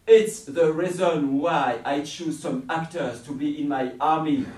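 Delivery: loud, booming villain voice; commanding villain. Acted. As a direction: boom it like a tyrant. Villain reveals his army.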